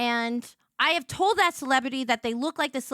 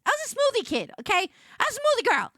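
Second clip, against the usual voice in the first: high playful defense; 10 semitones higher, faster than usual. Real, not acted. Mock self-defense.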